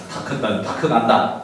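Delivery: ordinary delivery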